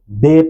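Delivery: "بے" as bay instead of baa; mistaken majhool reading. Shown as a demonstration. Teacher quoting a common mistake, "بے" as bay. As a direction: say "بے" with an Urdu ay. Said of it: "غلط پڑھتے ہیں".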